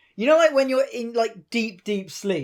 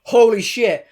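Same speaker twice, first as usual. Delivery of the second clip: put-on excited drunk outburst; shout; 3 semitones higher, 7 dB louder, slower than usual. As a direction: blurt it out loud. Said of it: "he shouts out".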